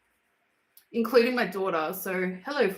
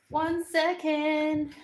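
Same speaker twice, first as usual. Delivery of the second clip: higher, stretched-out words; drawn-out sing-song; 5 semitones higher, much slower than usual. Real, not acted. Asking viewers to wait, in drawn-out sing-song. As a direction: draw it out high and slow.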